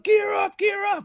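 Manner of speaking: very high barked order to move; urgent shout. Retold or imitated. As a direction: shout it to get everyone moving. Squad rushing out to help.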